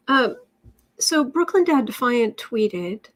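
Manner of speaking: ordinary talk, nothing marked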